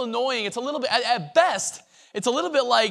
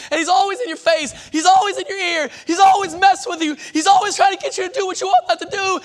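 rising preacher shout; 9 semitones higher, 6 dB louder than usual. Real, not acted. Preacher building up against the devil.

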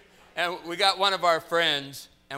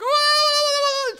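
loud, high drawn-out whoop; mock wild whoa; 13 dB louder than usual. Preacher acting out a story for laughs, wild whoop. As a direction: wail a long high whoa.

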